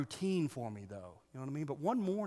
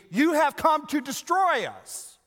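"You have come to destroy us!" shouted high and loud, slow; exclaimed; 13 semitones higher, 13 dB louder, slower than usual. Shown as a demonstration. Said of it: "It also could be".

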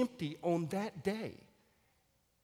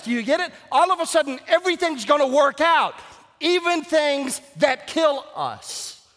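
loud, high, exultant pulpit cry; full-force preaching; 10 semitones higher, 9 dB louder than usual. Real, not acted. Pastor exhorting Baptists over the risen Christ, full-force preaching.

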